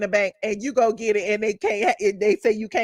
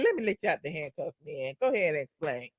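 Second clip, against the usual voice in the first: a hint of a soothing officer, fast and softer, voice lowered; calm reassurance; 8 semitones lower, 9 dB quieter, faster than usual. Imagined officer freeing the director, lightly voiced soft calm. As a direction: ease him out of it kindly.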